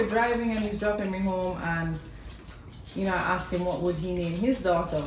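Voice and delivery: ordinary talk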